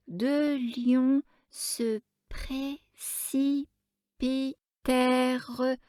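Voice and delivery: put-on high, very slow, solemn child's reading; a little girl reading a saint's life in earnest; child reading gravely. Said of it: "l'enfant continuait sa lecture d'un air grave". (lecture d'enfant grave, haute et très lente)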